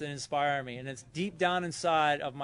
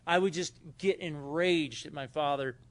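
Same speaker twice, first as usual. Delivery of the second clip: normal talking voice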